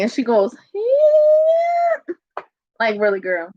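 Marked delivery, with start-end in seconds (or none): 0.7-2.1 very high, long mock whine; imitated fake crying. Showing the toddler's reaction, high drawn-out mock cry. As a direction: squeal out a toddler's fake whimper. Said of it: "She was doing fake crying".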